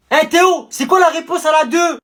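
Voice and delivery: loud call across the class; exam-room shout to a mate. Acted. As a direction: shout it over to Théo. Pupil calls to classmate in exam.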